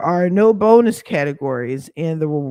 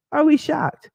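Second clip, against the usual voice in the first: mock shock, pitched much higher; 10 semitones higher than usual. Vlogger teasing viewers about the bank, mock shocked. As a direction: play up fake surprise at the bank.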